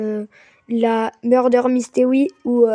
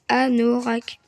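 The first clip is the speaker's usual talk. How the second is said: ordinary talk, nothing marked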